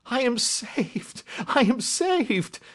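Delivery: high, slow cry of relief; overjoyed gasp. Acted. Banker clutching his recovered gems, gasping with joy. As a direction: draw each word out high, overcome with joy. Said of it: "With a shriek of joy".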